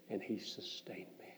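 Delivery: breathy whispered close. Acted. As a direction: let the line fall to a whisper.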